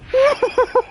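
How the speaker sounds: shrill laughing squeal; high squeal laugh. Real, not acted. Laughing at game moment.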